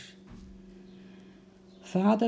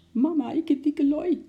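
put-on high, loud crowing baby voice; crowing toddler; 12 semitones higher, 4 dB louder than usual. A narrator's reading. Toddler crowing happily to his mother. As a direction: squeal it out like a delighted toddler. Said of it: "kraaide Bobby".

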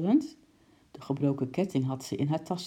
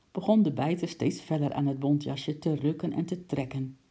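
ordinary delivery, nothing marked

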